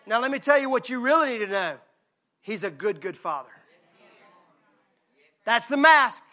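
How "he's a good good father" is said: softly, dropping low on good father; hushed and tender, like a lullaby line. Real, not acted. Preacher softening to a hush for the congregation. Soft tender aside in sermon.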